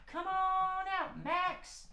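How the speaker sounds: high puppet cat. Acted. Max the cat greets the kids, high puppet voice. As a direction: squeak it out like a little cat.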